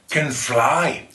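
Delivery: usual speaking voice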